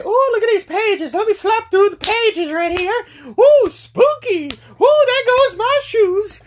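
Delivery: hammed-up high old lady voice; old lady reading. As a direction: coo a mock spooky ooh in a high granny voice. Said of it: "I'm trying to make it sound".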